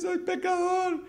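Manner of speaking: put-on high, slow tearful child voice; crying little boy. Son confessing to his father, high and tearful. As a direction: sob it out like a scared small boy.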